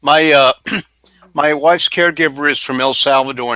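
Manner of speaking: ordinary delivery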